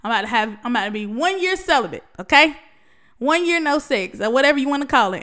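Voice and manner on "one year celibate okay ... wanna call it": louder and higher, punching one year no sex; loud emphatic announcement. Real, not acted. Proud vlogger announcing celibacy to her viewers, loud and lifted. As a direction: announce it proudly to the whole audience.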